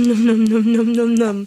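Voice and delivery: playful loud chomping noises; nom nom chomping. Pretend. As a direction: gobble the toes up, fading out. Parent chomping on baby's toes.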